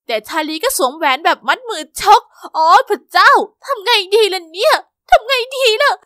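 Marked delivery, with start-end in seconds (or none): 2.6-5.9 higher, louder frantic fretting; rising panic, what do I do; panicking. Acted. Girl panicking as the ring slides on, frantic higher voice.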